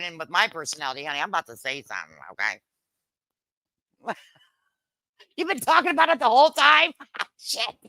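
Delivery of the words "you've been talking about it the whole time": high, loud, fast surprise; surprised. Real, not acted. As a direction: gasp it out, higher and quicker. Surprised at missed chat.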